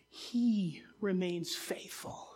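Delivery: very slow hushed promise; whispered, slow. Acted. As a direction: whisper it slowly, with awe. Scripture line hushed and slowed.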